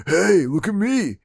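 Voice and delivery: lightly ape a bad French Canadian accent. Mocking the actor's bad French Canadian accent from the film, slow and light. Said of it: "He has a bad French Canadian".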